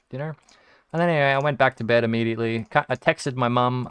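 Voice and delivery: normal talking voice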